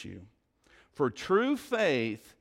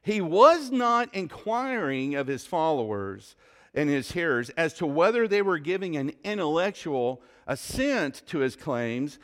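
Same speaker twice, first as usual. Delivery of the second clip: ordinary talk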